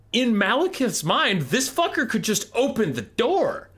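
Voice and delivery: can't believe how stupid this is; exasperated rant. Real, not acted. Incredulous rant about the plan.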